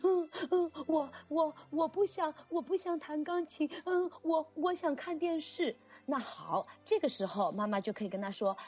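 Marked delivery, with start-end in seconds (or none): 0.0-5.8 put-on high, sobbing, stammering kid voice; crying child. Teacher imitates child sobbing he wants TV, high and tearful. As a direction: hiccup through the words like a tearful kid.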